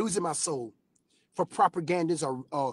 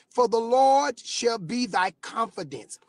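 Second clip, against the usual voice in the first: scripture reading; 4 semitones higher, slower than usual. Acted.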